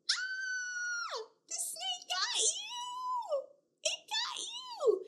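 very high playful squeal; excited squeal. Acted. Teacher squealing when the pretend snake pounces.

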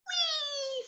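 very high, stretched squeal; gleeful. Acted. Boy going down the slide, high gleeful squeal. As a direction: let out a long high whee.

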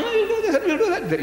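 high, quick mocking chatter; mock boasting. Mimicking the guy who talks instead of striking, mock boasting.

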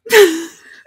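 a sudden loud laugh; loud burst of laughter. Real, not acted. Laughing out loud at a creepy clip, loud burst of laughter.

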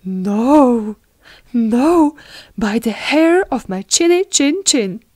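defiant little pig. Acted. Pig refusing the wolf at the door, defiant. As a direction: refuse loudly, pitch lifting as it goes.

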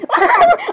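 very high burst of laughter; high squealing laugh. Acted. Speaker after asking if Michael is okay, squealing laugh.

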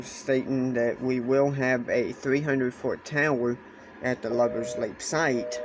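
normal talking voice

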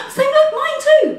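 high gasp of delight; excited gasp. Real, not acted. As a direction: gasp then gush with excitement. Excited shared favourite.

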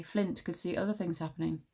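ordinary delivery

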